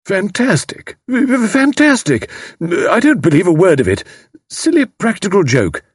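high, loud blustering man; scoffing disbelief. A narrator's reading. Mister Cayley dismisses the note as joke, scoffing. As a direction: splutter it high, stretching the repeat.

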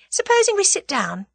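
high, light polite suggestion; sweetly courteous, lifted pitch; pleasant. A narrator's reading. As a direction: propose it pleasantly, as a hostess would. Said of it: "she said pleasantly".

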